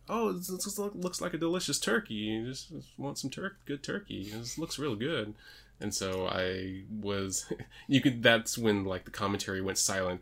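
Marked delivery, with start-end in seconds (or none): 0.1-5.3 higher, softer imitated backpedalling; imitating Byron Saxton. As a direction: cover up a slip in a small, high voice. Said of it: "And Byron was like".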